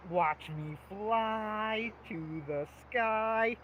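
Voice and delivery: very slow, drawn-out goofy puppet line; Grover voice. Retold or imitated. Quoting the skit to friend, slow goofy Grover voice. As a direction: stretch every word like a silly puppet. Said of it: "just from the guy going".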